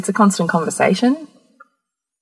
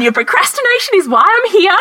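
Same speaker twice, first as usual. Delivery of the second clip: much higher, louder, mock-furious rant; retold rant; 13 semitones higher, 4 dB louder than usual. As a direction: hammer him about his procrastination, half laughing. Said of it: "this five-minute rant".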